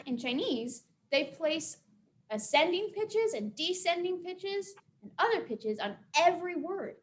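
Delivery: ordinary delivery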